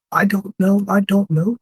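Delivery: high-pitched acted fear; scared. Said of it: "He is scared enough".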